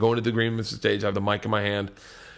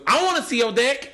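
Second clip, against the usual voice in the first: higher mock girl request; pitched up girlish call; another girl; 10 semitones higher than usual. Second girl shouts request from crowd, pitched up. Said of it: "Another girl goes".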